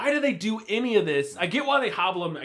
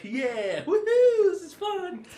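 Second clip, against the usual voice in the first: mock cheering; 13 semitones higher, slower than usual. Podcaster voicing the cultists enjoying the torture, mock cheering. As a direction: imitate a gleeful crowd, half-hearted. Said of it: "everybody around's like".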